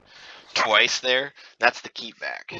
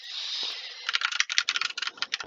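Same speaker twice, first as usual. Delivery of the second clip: disbelieving laugh; 5 dB quieter than usual. Real, not acted. Fails achievement while explaining it.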